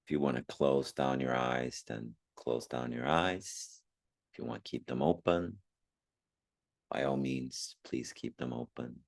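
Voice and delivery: calm meditation guide with long pauses; unhurried meditation voice, phrase by phrase; guided meditation. Acted. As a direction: gently guide listeners inward, leaving long silences.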